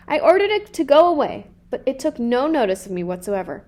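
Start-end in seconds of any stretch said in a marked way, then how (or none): none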